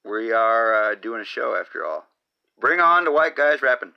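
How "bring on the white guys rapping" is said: loud announcing. Acted.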